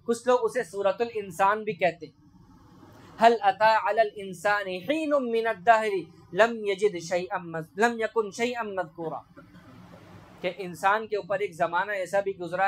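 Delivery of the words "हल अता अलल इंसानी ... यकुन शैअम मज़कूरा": Quran recitation. Acted. Preacher reciting the verse to his listeners, lightly chanted. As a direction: intone the verse gently, like recitation.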